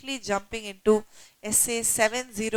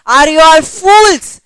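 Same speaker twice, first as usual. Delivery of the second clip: loud, higher-pitched rebuke; exasperated public scolding; 5 semitones higher, 13 dB louder than usual. Acted. Horlicks scolding the public in a service message, loud and exasperated. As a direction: scold them at full volume, acting out the message. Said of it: "Horlicks gave a public service message saying".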